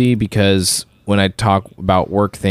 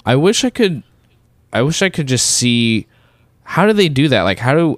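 ordinary delivery

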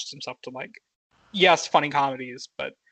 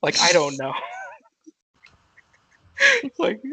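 usual speaking voice

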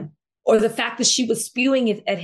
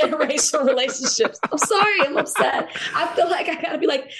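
laughing; 5 semitones higher than usual. Real, not acted. Laughing after co-host's correction.

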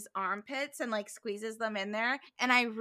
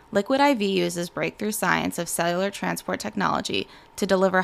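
steady, lower sponsor-read voice; 4 semitones lower, 9 dB louder than usual. Real, not acted. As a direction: pitch it evenly to the listeners. Reads sponsor ad.

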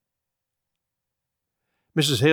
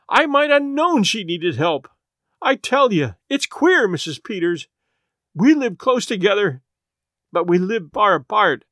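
distraught; 6 semitones higher than usual. A narrator's reading. Distraught confession to friend.

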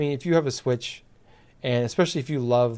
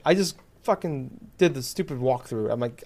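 ordinary delivery

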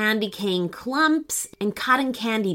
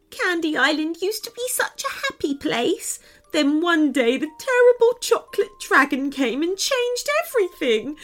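put-on high, earnest girl's storytelling voice; upset little girl; 6 semitones higher than usual. Runaway girl explains the dragon to the friends, upset. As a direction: tell a woeful tale in a high little voice. Girl tells her sad tale.

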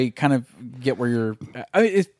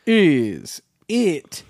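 shouted catchphrase; 2 semitones higher, much slower than usual. Acted. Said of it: "show catchphrase".

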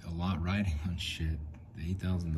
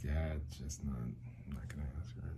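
low, much softer, resigned self-talk; reluctant murmur; 3 semitones lower, 8 dB quieter than usual. Retold or imitated. Talking himself out of asking her, in a reluctant murmur. Retells his reluctant inner decision.

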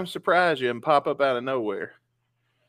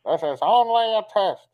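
raised, loud recorded announcer read; emergency broadcast voice; 7 semitones higher, 4 dB louder than usual. Retold or imitated. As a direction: imitate the alert recording over the speaker. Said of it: "You'll hear it talking".

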